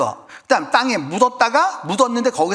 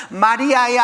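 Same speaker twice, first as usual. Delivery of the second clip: 마리아야 in a Gyeongsang accent, a bit louder; 5 semitones higher, 5 dB louder, slower than usual. Shown as a demonstration. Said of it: "경상도 버전으로 하면 뭐라고 그러는 거야".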